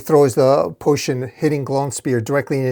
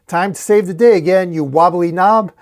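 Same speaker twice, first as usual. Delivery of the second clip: higher, louder taunting call; yell; 5 semitones higher, 5 dB louder than usual. A narrator's reading. Ghost chicken charging at Glowing Spear, a performed yell. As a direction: cry it out cockily. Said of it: "ghost head chicken yell".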